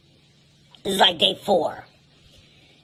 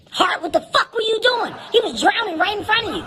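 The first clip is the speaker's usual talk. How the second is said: high, fast angry scolding; angry chewing out; 12 semitones higher than usual. Retold or imitated. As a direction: tear into him, high and fast. Other lifeguard yelling at Hart.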